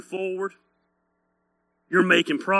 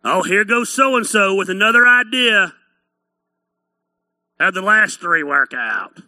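jeering onlooker, pushed louder at first; mocking; 5 dB louder than usual. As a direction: scoff at someone's latest idea. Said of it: "They'll mock you".

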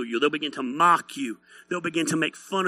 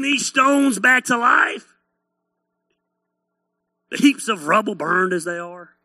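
hillbilly mocker; 3 semitones higher than usual. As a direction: jeer like a doubting hillbilly. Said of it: "inbred hillbillies are the type people that mock you".

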